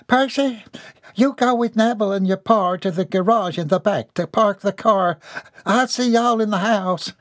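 high, folksy mother giving instructions; country mother, bossy. A narrator's reading. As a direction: organize the family in a high country voice.

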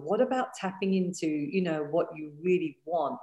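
usual speaking voice

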